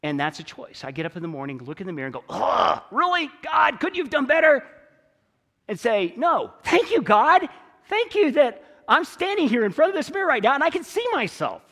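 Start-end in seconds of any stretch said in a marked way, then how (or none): 2.3-4.6 groan, then higher, louder exasperated protest; mock dismay at your own reflection; disgusted complaint. Preacher playing himself complaining to God at the mirror, exasperated. 6.2-11.6 high, loud, insistent thanks; hammed-up grateful exclamation to God; emphatic gratitude. Preacher answering his own mirror complaint, emphatically thankful.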